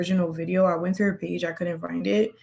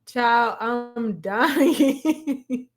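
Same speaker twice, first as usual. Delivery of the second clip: higher voice cracking into giggles; dying laughing; 4 semitones higher, much slower than usual. Real, not acted. Reactor reacts to a viewer's comment, dying laughing. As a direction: laugh right through the words.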